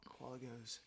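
usual speaking voice

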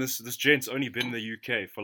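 ordinary delivery

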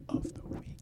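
hushed whisper trailing off. Real, not acted. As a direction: drop to a whisper. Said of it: "whispered end of question".

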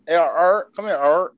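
mock calling someone named "art"; dropped R's. Shown as a demonstration. Friend demos how R's get said, calling out "art".

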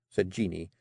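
ordinary delivery, nothing marked

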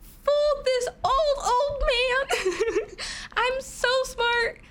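put-on very high, loud squealing kid; excited little kid. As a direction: squeal like a proud child. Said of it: "And I was like".

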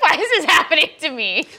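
high, loud hammed-up wail; mock wailing. Host acts out her own crying fit, mock wailing. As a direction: cry it out in mock despair. Acting out herself crying even more.

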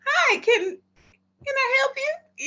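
high, slow, overly sweet "can I help you"; chirpy store-clerk greeting; polite high customer-service voice. Retold or imitated. Store manager greeting a staring stranger, high and sweet.

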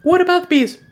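high, fast little bat voice. Acted. GM voicing the bat.

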